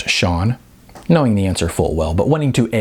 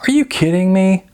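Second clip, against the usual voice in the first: wailing; 11 semitones higher, 4 dB louder than usual. A narrator's reading. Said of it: "Jose wailed".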